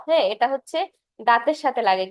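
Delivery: ordinary delivery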